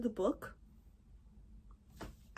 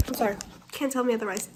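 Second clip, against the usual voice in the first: ordinary talk, nothing marked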